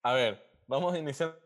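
ordinary delivery

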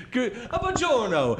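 high, slow put-on Italian "buongiorno"; hammed-up Italian hello; mock Italian greeting.

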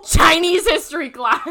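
excited recall. Retold or imitated. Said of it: "my head went".

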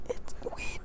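whispering close in the ear; hushed secret whisper; whisper in left ear. Acted. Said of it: "Why are you whispering in my ear?".

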